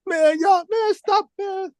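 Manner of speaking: high, squeaky mock protest; mock-embarrassed falsetto laughing off a compliment; bashful protest. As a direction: brush off the praise in a falsetto squeal. Embarrassed by praise, retold.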